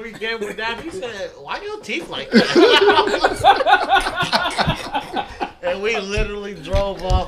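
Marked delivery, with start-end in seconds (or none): 1.4-3.5 loud, slow and high with laughter; blunt kid question. Retold or imitated. Kid blurts out a rude question. 3.5-5.6 loud, high, helpless laugh; burst of laughter. Real, not acted. Laughing at his own story.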